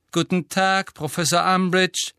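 a loud, dutiful, bored chant; bored chorus. A narrator's reading. The pupils answer the teacher in chorus, bored and dutiful. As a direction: chant it back as if forced. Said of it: "erwiderten sie gelangweilt im Chor".

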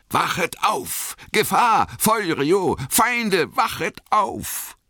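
very high, drawn-out alarm cry; ringender Alarmruf. A narrator's reading. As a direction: call out like a horn tearing through the night. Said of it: "erschallte zwischen den nahen Bäumen ein Horn". (hoher, gedehnter Alarmruf wie ein Horn)

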